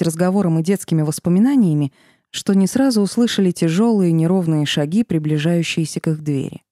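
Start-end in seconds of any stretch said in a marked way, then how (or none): none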